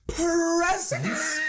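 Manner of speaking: answering roll call like an eighteenth-century schoolboy; old-fashioned roll call. Retold or imitated. Student calling out to teacher in old-timey tone. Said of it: "I said it in that tone of voice".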